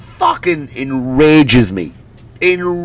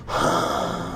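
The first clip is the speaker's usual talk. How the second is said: bored sigh; 10 semitones lower, 10 dB quieter than usual. Acted. Bored teen barista sighs.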